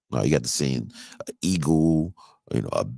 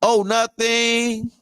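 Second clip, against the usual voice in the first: put-on innocent kid voice; 3 dB louder, much slower than usual. Kid answering dad, lying.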